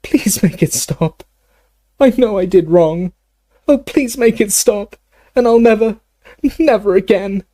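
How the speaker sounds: high-pitched, desperate begging; sobbing plea. Acted. Dumbledore begging forgiveness and relief, high sobbing plea. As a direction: plead high, on the edge of sobs.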